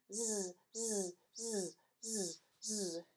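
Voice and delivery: soft drawn-out zzz snores; snoring. Acted. Amy snoring in bed, soft zzz snores. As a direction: snore softly, child fast asleep. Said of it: "went to sleep on the floor".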